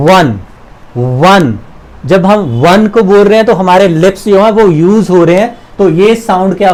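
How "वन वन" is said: वन said with a rounded-lip w sound at the start; teacher sounding out वन with rounded lips. Shown as a demonstration. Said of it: "उच्चारण करके देखते हैं".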